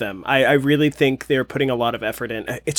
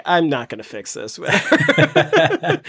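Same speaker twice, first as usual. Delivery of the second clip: New Yorker voice; 4 semitones higher, slower than usual. Retold or imitated. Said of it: "And then I was like".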